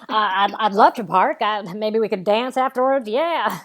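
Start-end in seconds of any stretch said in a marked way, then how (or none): none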